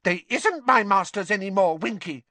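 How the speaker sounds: high, loud elf standing firm; emphatic little elf retort; defiant. A narrator's reading. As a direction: squeak back in defiance. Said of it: "said Dobby defiantly".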